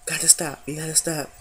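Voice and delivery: quietly telling herself to hold back tears; self talk. Retold or imitated. Holding back tears, she hushes herself softly. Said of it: "I'm like".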